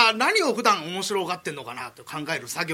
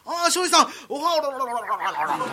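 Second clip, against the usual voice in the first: mock vomiting; 6 semitones higher than usual. Vomiting mid-greeting, acted.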